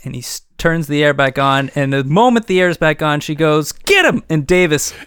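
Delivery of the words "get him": high, sharp order; sudden command. Acted. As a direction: bark it out to launch the attack. [name] orders the attack.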